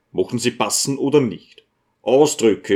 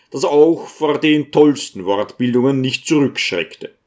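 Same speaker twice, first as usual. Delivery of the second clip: ordinary delivery, nothing marked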